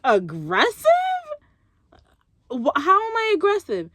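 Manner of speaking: a touch of mock outrage; offended disbelief. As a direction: repeat the word back, stunned. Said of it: "I'm like".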